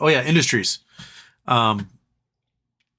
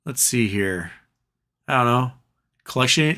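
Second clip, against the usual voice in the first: ordinary talk, nothing marked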